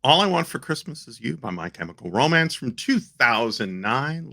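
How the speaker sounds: ordinary delivery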